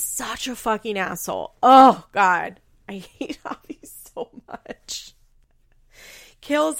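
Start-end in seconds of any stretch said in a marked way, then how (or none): 1.6-2.6 loud exasperated outburst; loud, real exasperation. Real, not acted. Exasperated at Javi's response. 2.9-5.1 slow, soft words squeezed through laughter; voiceless, high, laughing; laughing exasperation. Real, not acted.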